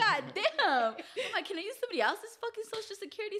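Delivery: high, fast, laughing disbelief; excited. Retold or imitated. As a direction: blurt it out, amused and exasperated.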